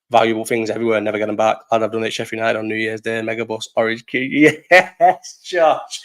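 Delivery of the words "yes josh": raised, drawn-out cheer of approval; triumphant cheer. Acted. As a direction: cheer on a mate, higher and louder. Cheering a fellow football fan.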